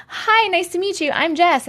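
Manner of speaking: mock social introduction; acted introduction. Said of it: "I'm that person that literally says, like".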